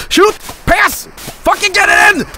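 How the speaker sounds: high, urgent shouted commands; heated gamer screaming; frantic shouting. Real, not acted. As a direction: yell desperately at the players. Yelling orders near goal.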